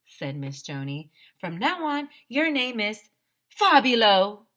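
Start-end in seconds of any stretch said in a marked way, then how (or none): 1.4-4.5 high, loud build to Fabulo; hammed-up diva proclaiming; grand proclamation. A narrator's reading. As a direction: crown him with the name. Miss Joni proclaims name.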